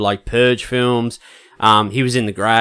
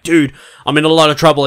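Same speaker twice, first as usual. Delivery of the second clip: urgent plea; 5 semitones higher, 6 dB louder than usual. Retold or imitated. Retold desperate phone message.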